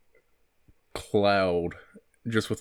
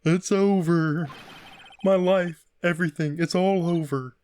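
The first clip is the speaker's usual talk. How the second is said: Kermit the Frog; 4 semitones higher than usual. Acted. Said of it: "almost like so, someone trying to do Kermit the Frog".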